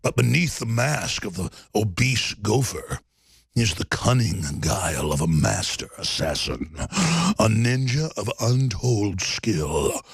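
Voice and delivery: low, slow trailer voice; deep dramatic movie voice. Pretend. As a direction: intone it like an epic villain intro. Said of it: "This is how Kim Jong-un would sound".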